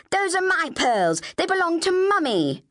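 high, distressed wail of protest; aghast older sister; horrified outcry. Acted. Sister sees her pearls in acid.

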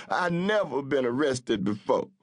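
tearful pleading. A narrator's reading. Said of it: "The tears began streaming down his face".

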